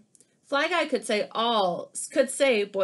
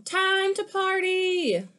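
party call; 10 semitones higher, 4 dB louder, much slower than usual. Acted. Buzz opens window to flies.

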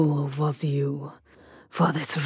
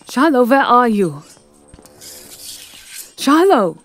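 loud, higher desperate call; 7 semitones higher, 7 dB louder than usual. Acted. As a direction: shout her name across the house. Calling out for missing Shiloh.

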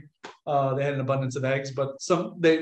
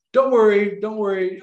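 eager reassurance; 6 semitones higher, 5 dB louder than usual. Retold or imitated. Quotes teenagers reassuring him.

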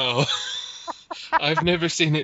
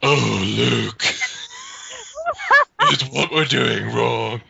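raspy voice; 4 dB louder, slower than usual. Retold or imitated. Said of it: "you probably have a raspy voice like".

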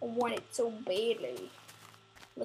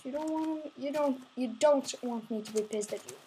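usual speaking voice

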